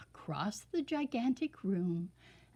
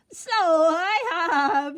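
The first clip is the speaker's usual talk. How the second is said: high, louder, slow delighted giggle; giggling king; 8 semitones higher, 10 dB louder, slower than usual. A narrator's reading. The king delighting in himself, loud slow giggle. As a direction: let the surprise bubble into a giggle. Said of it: "he giggled".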